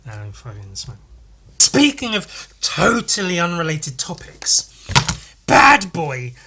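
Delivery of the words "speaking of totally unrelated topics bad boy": higher, slow, drawn-out announcement; hammed-up title announcement, stretched and rising; announcer segue. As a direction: build to a loud, high title shout. Over-the-top segue announcing next topic.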